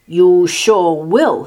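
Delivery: loud, slow, indignant retort; snorting retort. A narrator's reading. Pig answers Martina's offer, snorting retort. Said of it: "he snorted".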